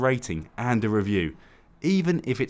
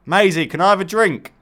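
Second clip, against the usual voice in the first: raised, higher-pitched call to someone; 5 semitones higher, 4 dB louder than usual. Real, not acted. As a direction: call over for a favour. Calling to Maisie for a drink.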